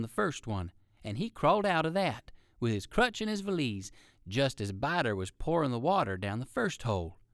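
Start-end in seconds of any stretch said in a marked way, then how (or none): none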